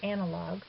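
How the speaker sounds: analog with the first syllable stressed, AN-a-log. Shown as a demonstration. Teacher showing English accent in a word like analog, first-syllable stress. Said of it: "the accent is on the first syllable".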